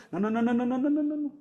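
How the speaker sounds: high-pitched string of rapid-fire noes; rapid refusal. Acted. Acting out refusal of excuses.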